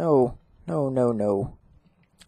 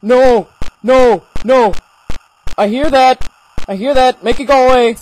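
higher, louder, repeated panicked cries; terrified; 5 semitones higher, 6 dB louder than usual. Real, not acted. Player hearing the monster approach, terrified. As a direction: panic as the threat gets nearer. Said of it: "this is terrifying".

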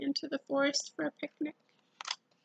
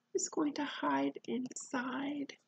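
ordinary delivery, nothing marked

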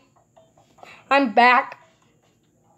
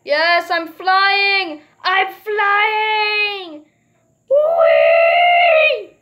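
excited shout; 7 semitones higher, 6 dB louder, much slower than usual. Real, not acted. Goat launched into the air.

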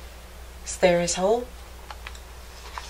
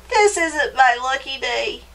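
higher-pitched, woeful mouse voice; complaining mouse; 5 semitones higher than usual. A narrator's reading. Mouse laments after falling to the ground, higher complaining voice. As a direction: voice a sorry little mouse, high then sinking.

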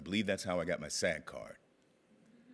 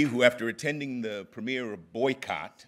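ordinary talk

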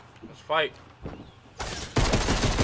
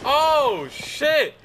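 high loud shocked shout; startled yell; 12 semitones higher, 7 dB louder than usual. Real, not acted. Gamer surprised by an enemy, shouting. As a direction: jump at the sight.

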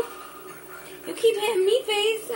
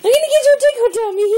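quick, loud, high tease; excited fast tease; 5 semitones higher, 7 dB louder, faster than usual. Real, not acted. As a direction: rush it out gleefully. Fast excited tickle threat.

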